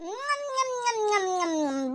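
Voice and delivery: repeated soft munching hums; savouring nom-nom noises; relishing munching. Acted. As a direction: hum through each tasty mouthful. Eating the biryani with relish.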